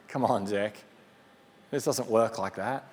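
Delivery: low dismissive chiding; mock naysayer. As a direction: scoff like a doubtful neighbour. Naysayers mocking Zechariah.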